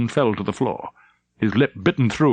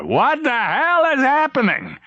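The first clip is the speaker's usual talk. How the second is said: high, slow strained bark of fury; a livid admiral demanding answers; angry admiral. Acted. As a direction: admiral storms onto the bridge, high and slow. Furious admiral arriving.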